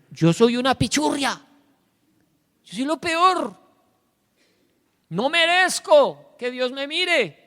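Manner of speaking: high, loud, mock-despairing wail; self-abasing lament. Preacher voicing a broken sinner, high despairing wail. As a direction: wail that you are the worst. Sinner confessing unworthiness, voiced.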